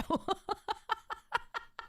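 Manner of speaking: long run of high, repeated giggles; amused laugh. Real, not acted. Streamer tickled by a viewer's comment, long giggle. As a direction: keep giggling in high, even bursts.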